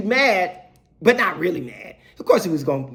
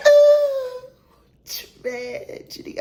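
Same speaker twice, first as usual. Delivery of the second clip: drawn-out whispered dismay; dreading whisper; much slower than usual. Retold or imitated. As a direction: whisper it slowly in mock horror. Mimics dread at bad news.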